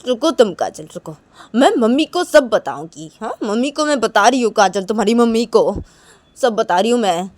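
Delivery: put-on insistent, scolding young woman in a quarrel; Reshma threatening to tell. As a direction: threaten to tell on her, firmly. Acts Reshma threatening to tell mother.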